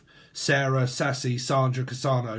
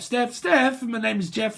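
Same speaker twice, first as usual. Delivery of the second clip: higher-pitched silly name-calling; mock introduction; 8 semitones higher than usual. Reader jokes about rhyming names, mock introduction. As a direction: call the rhyming names out high, playfully.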